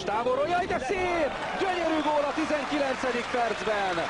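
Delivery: delighted, high goal cry; excited hungarian sports commentary. Real, not acted. Long-range goal, delighted outburst. (lelkes, magas hangú gólkiáltás)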